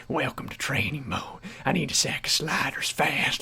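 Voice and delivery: put-on quiet old-timer's Southern voice; old Southern man. Gamer mimicking the training-mode mechanic, old Southern man. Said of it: "sounds like a sixty-five-year-old Southern man".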